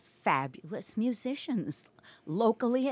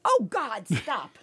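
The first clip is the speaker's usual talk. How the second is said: playful protest; 2 semitones higher, 4 dB louder, much slower than usual. Real, not acted. Recoils at teasing about lutefisk.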